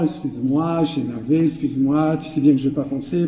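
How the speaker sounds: low, subdued make-up voice; mock apology. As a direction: act out a sheepish spouse backing down. Acted apology after the quarrel.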